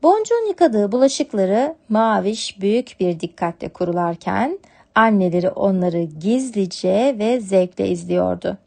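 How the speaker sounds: plain reading voice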